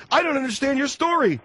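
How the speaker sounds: high-pitched, frustrated outburst; exasperated. Acted. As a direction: voice climbing, fed up with the caller. Host loses patience with caller.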